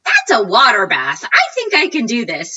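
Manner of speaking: a thrilled little eureka to herself; excited self-quote. Retold or imitated. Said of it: "I'm like".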